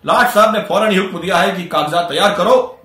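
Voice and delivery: loud, emphatic bragging; acted self-important declaration; boastful proclamation. A narrator's reading. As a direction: announce it like an official decree. Munshi brags of the order received.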